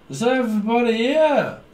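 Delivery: mock drunken announcer grabbing the mic; party host call. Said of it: "Is what I keep hearing".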